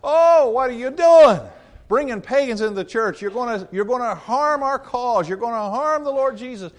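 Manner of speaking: high, loud alarmed outcry; hammed-up panic over the pagans; panicked critics. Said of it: "they're freaking out".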